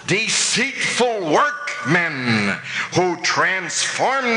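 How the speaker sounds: very slow, stretched-out preaching delivery; weighty, slow reading that climbs at the end; emphatic scripture reading. Acted. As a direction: draw out each damning word for the congregation. Emphatic reading of the damning verse.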